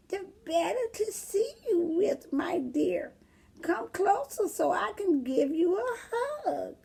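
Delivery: put-on very high, croaky old lady voice; wolf hiding behind a sweet granny voice; fake granny. Disguised wolf lures the girl, high and croaky.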